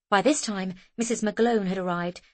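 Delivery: ordinary delivery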